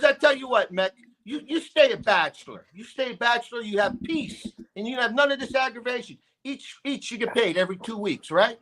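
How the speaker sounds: father telling son to stay a bachelor, high-pitched; imitating dad's advice. Dad advising bachelorhood.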